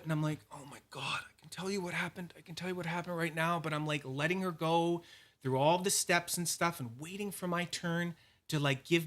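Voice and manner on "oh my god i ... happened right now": hushed, impatient aside; quiet retold exasperation; exasperated inner voice. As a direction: say it under your breath, itching to interrupt. Silent exasperation while clerk checks.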